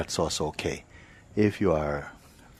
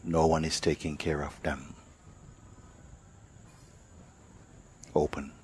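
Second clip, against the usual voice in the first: guided meditation; 3 dB quieter than usual. Real, not acted. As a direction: lead them unhurriedly, phrase by phrase, into awareness.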